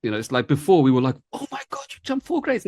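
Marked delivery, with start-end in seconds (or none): 1.3-2.7 much higher, gasping mock amazement; amazed. Teacher recalling how staff once reacted to students' jumps, high excited disbelief. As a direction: squeal it like unbelievable news.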